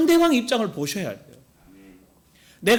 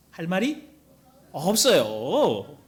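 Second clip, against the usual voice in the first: drawn-out answer; 2 semitones lower, much slower than usual. Real, not acted. Preacher slowly drawing out the answer. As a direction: stretch 없어요 very slowly.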